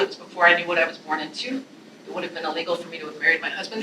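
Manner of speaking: quiet recap of the poem line; quick quiet recap. Shown as a demonstration. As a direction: rattle the line off to make a point. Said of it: "is her voice nice in that poem?".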